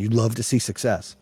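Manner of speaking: normal talking voice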